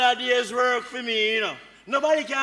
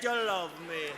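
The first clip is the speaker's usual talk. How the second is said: much lower and softer; hushed insistence; 9 semitones lower, 11 dB quieter than usual. Acted. Man tells unwilling woman she loves him, hushed insistence. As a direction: drop to a low, intimate menace. Same claim repeated, now hushed.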